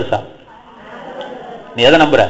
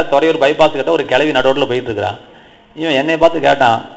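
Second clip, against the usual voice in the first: ordinary talk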